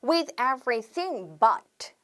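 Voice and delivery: With everything but drawn out very slowly; slow pattern demo. Shown as a demonstration. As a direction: spell out the pattern word by word. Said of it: "이게 패턴이 되는 겁니다". ("With everything but"을 아주 천천히 또박또박)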